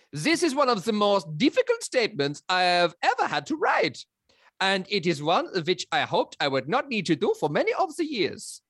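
high-swooping hammed-up German accent; mock-solemn German accent sliding toward French on the high notes; Mister Gruber accent. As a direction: read it in a thick, theatrical German accent that keeps leaping high. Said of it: "I do an accent for Mister Gruber".

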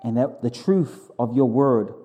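fervent, earnest pastor's prayer. Acted. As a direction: pray it out boldly to God. Leads the congregation in prayer.

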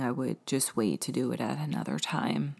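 ordinary talk, nothing marked

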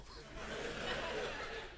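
breathy laugh at his own refusal; a short real chuckle; amused laugh. Real, not acted.

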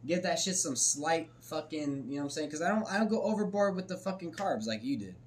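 usual speaking voice

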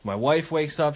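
usual speaking voice

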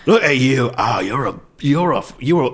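loud leering Cockney; Cockney old pervert. Acted. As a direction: ham up a Cockney lecher. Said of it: "Cockney character I've ever done".

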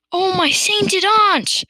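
loud, high, drawn-out exclamation; aghast cry. A narrator's reading. Farmer appalled at the chicken on stilts, crying out. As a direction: wail it out slowly, aghast. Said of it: "cried Mister".

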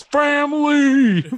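stretched high shout, laughing through it; Vin Diesel's Family. Retold or imitated. Co-host mocks the Iron Giant's only line, hammed-up yelling. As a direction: belt out the one word, slow and huge. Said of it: "He says".

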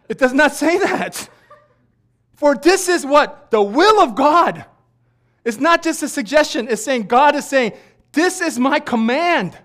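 loud, high, emphatic rebuke; forceful shouted proclamation, key phrases drawn out; preaching shout. Real, not acted. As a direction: thunder it from the pulpit. Preacher forcefully corrects congregation.

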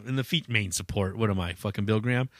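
normal talking voice

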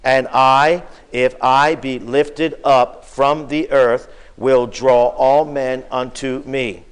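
loud, slow, weighty scripture reading; solemn scripture reading. Acted. Preacher leading the congregation in the verse, slow and loud. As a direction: read it out slowly for the whole church.

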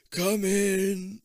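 lightly voiced old man from inside; a hint of an old man calling. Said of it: "the voice of a very old man invites".

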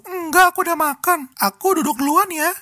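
high sugary girlfriend impression; high, coy girlish voice; cutesy girl voice. Friend's girlfriend voiced in story.

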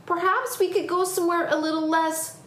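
put-on high, tentative child's suggestion; child character. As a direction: suggest it as a wary kid. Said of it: "panted Tom".

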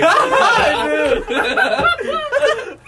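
high, loud, real laughing through words; teasing question through helpless laughter; laughing hard. Real, not acted. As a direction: shriek it out mid-laugh. Cracking up at friend's photo.

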